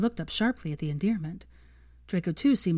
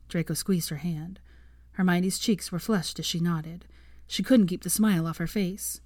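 plain reading voice

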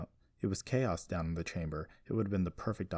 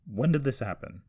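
raised, louder demanding question; sharp demand; 5 semitones higher, 5 dB louder than usual. A narrator's reading. Joseph questioning Jessie about her mother, demanding. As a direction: demand an answer. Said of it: "he demanded".

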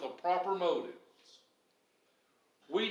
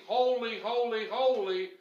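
mild shout; 6 semitones higher, 4 dB louder than usual. Retold or imitated. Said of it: "were shouting".

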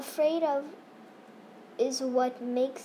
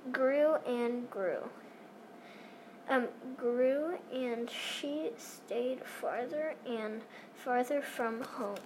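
quiet; 9 dB quieter than usual. Acted. Narration fading spooky.

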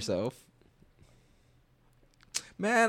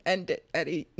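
imitated yell; 8 semitones higher than usual. Co-host repeating the order to Eddie, imitated yell. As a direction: mimic a friend yelling without the volume. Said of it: "he yelled".

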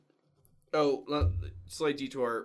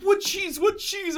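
very high, loud squeaky imitation; mock panicked friend; 7 dB louder, slower than usual. Teasing friend who can't order, high mock panic. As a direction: mimic a flustered friend in a raised pitch. Mimicking nervous friend at sub counter.

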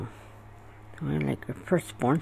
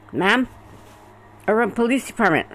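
brisk, louder officer announcing themselves; imitating police officer; 4 semitones higher, 5 dB louder than usual. Officers announce themselves at her bedroom, brisk and louder. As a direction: call it out like a cop at the door.

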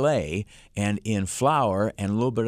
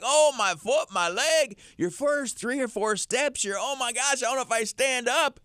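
put-on high, whiny complaining old-timer; achy old person. Morning aches voiced for ad listeners, whiny and high. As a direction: moan like stiff joints hurt. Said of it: "It's like".

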